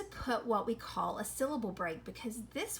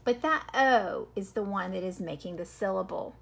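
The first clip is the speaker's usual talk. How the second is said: ordinary talk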